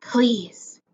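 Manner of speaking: pleading whisper. A narrator's reading. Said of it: "Lucinda whispered".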